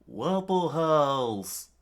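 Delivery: drawn-out grand announcement; hammed-up reveal; announced name. Naming the house form.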